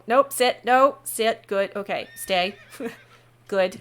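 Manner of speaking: loud firm commands to dogs; ordering the dogs. Acted. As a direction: bark commands at the dogs, half laughing. Dog owner ordering dogs offstage.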